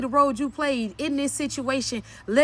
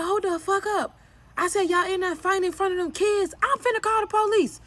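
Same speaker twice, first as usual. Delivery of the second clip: raised-pitch threat; mildly heated, higher-pitched order; retold stern warning; 5 semitones higher than usual. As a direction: re-enact breaking up a fight. Retold warning to the fighting couple.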